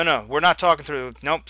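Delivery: ordinary talk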